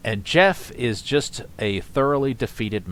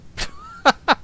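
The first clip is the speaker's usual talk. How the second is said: high unvoiced laugh; 3 dB louder than usual. Real, not acted. Laughs at the comment.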